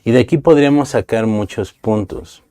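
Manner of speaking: ordinary delivery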